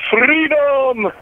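high, drawn-out mock battle cry; William Wallace. Caller greeting the show with a high tribute cry. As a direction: cry it out like a Scottish rebel leader. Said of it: "that was just my William Wallace tribute".

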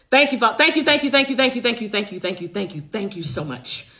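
gushing string of thanks that winds down; rapid repeated thanks. Real, not acted. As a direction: run of thank-yous tumbling downward. Piling up thanks to supporters.

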